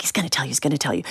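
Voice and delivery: fast, soft hushed repeat; quick reassurance. Retold or imitated. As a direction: rattle it off quietly.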